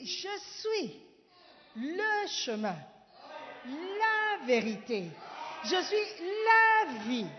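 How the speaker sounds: building from steady to shouting, slow; rising proclamation. Acted. Preacher to the congregation, building to a shout.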